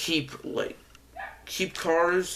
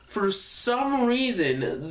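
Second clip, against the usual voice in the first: usual speaking voice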